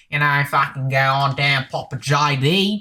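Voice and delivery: broad mock Australian accent; rough Australian accent. Contrasting the nicer Australian accents, rough Australian accent. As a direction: put on a coarse Aussie accent. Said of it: "aren't quite as like".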